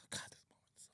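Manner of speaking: near-silent choked whisper; real tearful whisper; holding back tears. Real, not acted. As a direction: barely get it out, fighting tears. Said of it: "I'm gonna lose it".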